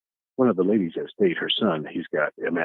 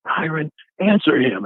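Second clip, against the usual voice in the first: higher, slow imitated order; retold sharp command; 8 semitones higher, 4 dB louder than usual. Dee Dee orders Tyron to answer in the dream, retold scolding command. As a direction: order him to answer, drawn out.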